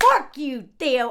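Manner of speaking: loud, angry curse; angry outburst. Real, not acted. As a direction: hurl it at him in anger.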